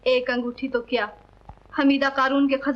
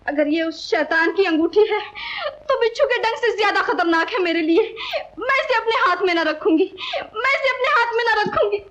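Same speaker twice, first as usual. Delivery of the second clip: high-pitched, tearful vehemence; distraught outburst; 9 semitones higher than usual. Acted. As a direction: build to a higher, agitated cry of refusal. Distraught rejection of the villain's ring. (रुआँसी, ऊँची आवाज़ में तड़पकर इनकार)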